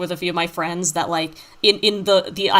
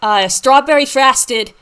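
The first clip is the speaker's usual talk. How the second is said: put-on thick Boston accent, higher and louder; 4 semitones higher, 6 dB louder than usual. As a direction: play it broad, like a Southie local. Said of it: "talks in an extremely thick Boston accent".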